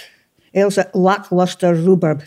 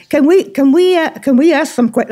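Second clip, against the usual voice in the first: a light hint of an Atlanta Georgia accent, a touch higher; playful American Georgia voice; 7 semitones higher than usual. Host voicing Atlanta friends asking, light Georgia accent. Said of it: "my Atlanta, Georgia accent".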